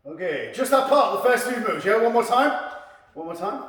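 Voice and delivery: ordinary talk, nothing marked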